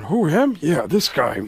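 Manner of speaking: needling celebrity impression with halting pauses; lightly voiced intense actor, sizing someone up; pointed, halting mock menace; Al Pacino. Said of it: "the whole time Pacino's looking, going".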